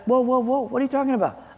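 high, softened mock protest as God; God interrupting. God questioning a complaining churchgoer, interrupting. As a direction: cut in as a puzzled God. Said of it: "You know what God says?".

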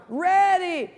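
high, loud "Ready, aim" called out; captain's command shout. Acted. Joke captain commanding the firing squad, loud shout.

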